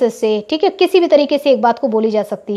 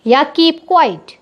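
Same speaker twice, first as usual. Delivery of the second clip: slow dictation; much slower than usual. Shown as a demonstration. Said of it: "दोनों एक ही बात है ना".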